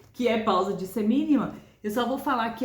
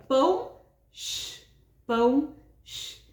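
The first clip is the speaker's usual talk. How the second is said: spoken rhythm with shush; slower than usual. Shown as a demonstration. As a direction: say pão, then hush the rest. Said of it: "eu tô falando sh".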